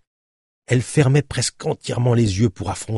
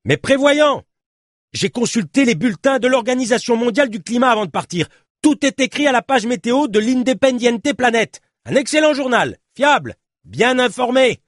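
high, insistent explaining; shouted justification; 3 dB louder than usual. Acted. Journalist defending his foresight to his companion, insistent shouting. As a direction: proudly make your case over a storm.

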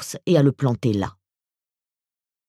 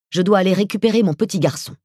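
rushed and raised, pushing to leave; hurried; 6 semitones higher, 4 dB louder, faster than usual. Acted. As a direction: blurt it out fast and urgent.

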